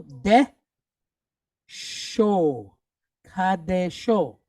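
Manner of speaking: slow, syllable-by-syllable "Kadesho"; sounded out slowly. Shown as a demonstration. Teacher piecing a Hebrew word together, slowly sounded out. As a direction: draw out each syllable of "Kadesho".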